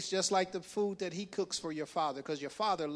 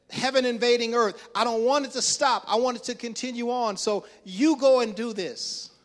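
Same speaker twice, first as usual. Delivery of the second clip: loud, raised, building plea; impassioned charge; 5 semitones higher, 9 dB louder than usual. Acted. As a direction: preach it out as an urgent command. Mother's urgent charge, preached at force.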